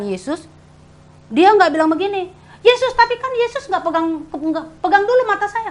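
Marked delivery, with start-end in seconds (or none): 2.6-5.7 high, put-on complaining voice; whiny complaining beggar. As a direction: grumble like an ungrateful beggar. Mock complaint of the blind man.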